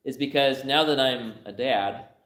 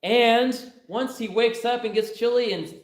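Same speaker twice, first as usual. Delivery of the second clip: higher and higher, building to a loud squealing laugh; daughter's plan building; 9 semitones higher, 5 dB louder than usual. Acted. Daughter acting out her proposal, rising to a loud giggly squeal. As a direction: build from eager to a shrieking, giggling climax. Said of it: "He said".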